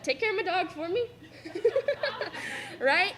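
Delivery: teary giggle in a thank you; laughing. Real, not acted. Graduate laughing while asking mom to mind the dog.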